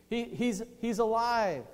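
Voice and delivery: high, stammering joyful realization; amazed joy. Acted. Women at his feet, amazed joy. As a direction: stumble into it, then exclaim it.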